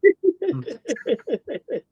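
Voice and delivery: soft chuckle; laugh. Real, not acted. Host relieved guest is still here, soft laughing.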